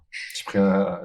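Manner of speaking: ordinary talk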